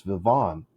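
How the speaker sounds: "Vivon" as vih-VON; retold correction, "Vivon" as vih-VON; stress on second syllable. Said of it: "If the second syllable is stressed".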